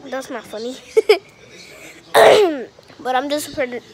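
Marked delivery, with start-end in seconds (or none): 2.1-2.7 loud burst of laughter. Real, not acted. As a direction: crack up loudly. Laughing at own pun.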